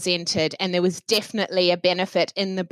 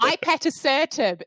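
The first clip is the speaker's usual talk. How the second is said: "ipatasertib" in a New Zealand accent; 8 semitones higher than usual. Shown as a demonstration. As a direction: say "ipatasertib" with Kiwi vowels. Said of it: "you all get my New Zealand accent".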